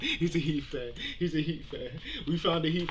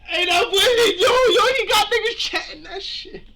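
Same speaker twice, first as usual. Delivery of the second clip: loud, high laughing yell; screaming laugh; 11 dB louder than usual. Real, not acted. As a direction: shout through laughter.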